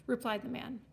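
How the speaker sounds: plain reading voice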